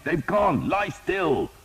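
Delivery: high, loud command; shouting. A narrator's reading. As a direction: hold him down with your voice. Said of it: "Thomas shouted".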